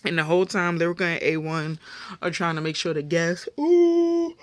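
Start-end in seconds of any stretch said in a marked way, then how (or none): 3.6-4.4 loud, high yawning sound; a yawn breaking into the sentence. Real, not acted. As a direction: yawn out loud mid-thought. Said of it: "didn't want to yawn".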